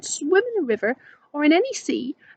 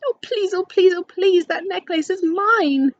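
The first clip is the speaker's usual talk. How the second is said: high, loud begging, slowing on the last words; pleading cry; 6 semitones higher, 5 dB louder than usual. Acted. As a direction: beg desperately to get the necklace back. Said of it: "she ran out ... and cried".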